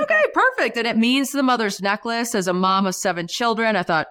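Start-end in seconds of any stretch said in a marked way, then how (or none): 0.0-0.8 chirpy okay. Retold or imitated. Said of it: "So I'm like".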